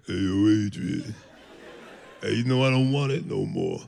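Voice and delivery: low mumbled Rocky mock. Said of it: "He's like".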